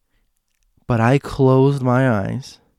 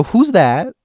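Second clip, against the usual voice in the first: higher, slow, louder frightened ask; scared question; 8 semitones higher, 6 dB louder than usual. Retold or imitated. Scared girl asks mom who's there. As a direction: ask it eyes shut, scared. Scared, eyes closed, asking.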